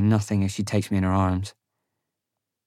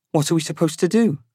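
higher-pitched character voice; high voice of a frustrated companion; exasperated companion; 8 semitones higher than usual. A narrator's reading. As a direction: ask it as a raised, challenging question. Companion's exasperated question, voiced by narrator.

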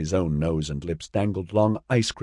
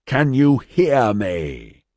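higher, drawn-out call, moderately raised; slow, raised call to rouse someone; shouted call; 5 semitones higher, much slower than usual. A narrator's reading. Man calls to the collapsed boy in the snow, shouting slowly. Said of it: "Ozzy shouted".

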